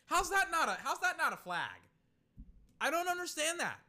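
loud, high-pitched protest; indignant outburst. Real, not acted. Streamer protesting a missing flag on the play, indignant. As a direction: cry foul in sudden disbelief.

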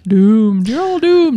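ominous prophet of doom wail; slow ominous raspy drawl. Retold or imitated. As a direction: warn them all, rising high and loud. Imitating the doomed guy.

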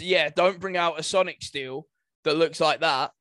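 ordinary talk, nothing marked

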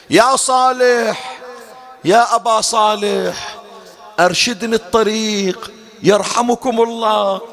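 slow, high, drawn-out plea to be rescued; a dying pilgrim lost in the desert, a slow high wailing call. Retold or imitated. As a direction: stretch each call like a dying man crying for help. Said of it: "قام يصيح".

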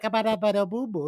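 reply in conversational nonsense syllables; comic gibberish imitation of wife; unintelligible smart talk. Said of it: "Are you guys speaking English?".

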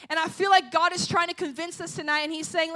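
ordinary delivery

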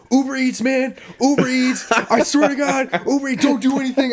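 high, loud, frantic begging at gunpoint; panicked pleading. Retold or imitated. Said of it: "He's like".

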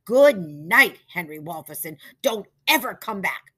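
put-on angry old lady shouting; shouted. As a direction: throw them out. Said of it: "shouted Missus Foley".

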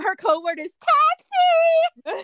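high, loud and slow mock secret; dramatic spy reveal. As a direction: unveil the code word with mock drama.

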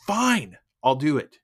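loud, high burst on "Fine"; exasperated giving in. Retold or imitated. Man worn down by the nagging prompt, exasperated outburst. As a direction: cave in with an exasperated shout. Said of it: "to the point where he's like".

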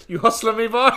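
loud, high cartoon confrontation; hammed-up cartoon challenge; Roger. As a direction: call out a hustler, high and loud. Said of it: "he turns to the guy".

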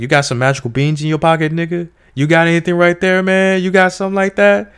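high, loud teasing challenge; mocking wife. Wife teases husband about magic beans, high mocking.

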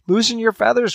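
high, pleading call; anxious cry. A narrator's reading. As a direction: cry out to her falling son. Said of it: "cried his mother".

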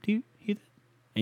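high, mock-startled squeak; hammed-up alarm, high and slowing; alarmed girlfriend. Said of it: "She goes".

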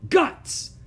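very high, loud yell. Acted. Ramona yells the bad word at her family. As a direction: scream the word at them. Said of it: "she yelled".

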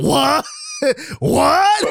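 mock the kids' disbelief; shocked what. Rookies hearing why the fight started, high shocked what.

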